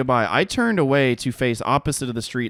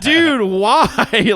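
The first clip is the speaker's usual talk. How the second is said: high, loud "dude, why" in disbelief; stunned protest; 9 semitones higher, 6 dB louder than usual. Retold or imitated. Buddy calling after the stranger who slapped the phone, stunned loud protest. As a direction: call after the guy, baffled. Buddy reacts to sudden hit.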